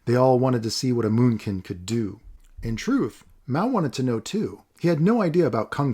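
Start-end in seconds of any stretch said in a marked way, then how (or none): none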